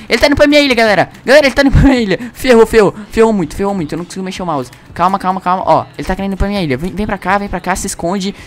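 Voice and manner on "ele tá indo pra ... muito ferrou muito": panicked shouting. Real, not acted. Panicking as enemy approaches.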